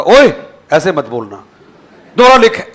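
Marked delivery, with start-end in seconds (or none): 0.0-0.3 loud, high rude "ओए" to call the teacher; rude shout. Shown as a demonstration. 2.2-2.7 loud, high, curt "दोबारा लिख"; bossy loud "दोबारा लिख"; rude command. Shown as a demonstration.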